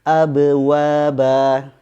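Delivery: slow model reading of abwaba; clean qalqalah. Shown as a demonstration. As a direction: abwaba with b bounced free of any vowel. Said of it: "misalnya pada kata".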